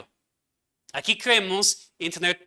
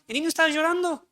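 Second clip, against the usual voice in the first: mock-alarmed repeat, pitched higher and louder; 10 semitones higher, 3 dB louder, faster than usual. Pastor mimics parents fretting over crying child, high mock alarm. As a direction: echo the panicked parent, voice jumping up high.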